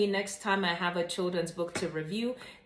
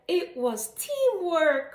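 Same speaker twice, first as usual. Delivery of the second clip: higher, louder, slow group cheer; joyful cry; 9 semitones higher, 4 dB louder, slower than usual. A narrator's reading. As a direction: chant it proudly together, drawn out. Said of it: "cried everyone".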